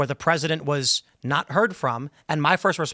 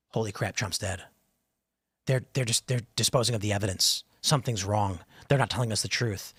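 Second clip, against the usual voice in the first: low, hushed quoting of conspiracy theorists; mock conspiratorial; 5 semitones lower, 5 dB quieter than usual. Forum posters spreading a death rumor, mock conspiratorial. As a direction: drop low and quieter, imitating suspicious forum posters.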